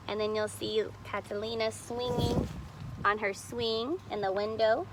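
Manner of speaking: put-on lively read-aloud voice for children; storybook reading. A narrator's reading. Teacher reading a picture book to kids, animated. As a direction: read the story to the class with expression.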